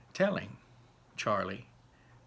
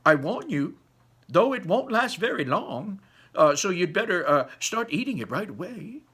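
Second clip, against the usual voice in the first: higher, louder warning voice; Mr Wonka warning; 8 semitones higher, 7 dB louder than usual. A narrator's reading. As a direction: caution the prince, half playful. Mr Wonka warns prince.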